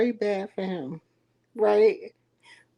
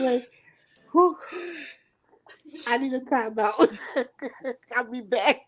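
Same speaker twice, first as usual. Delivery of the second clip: ordinary talk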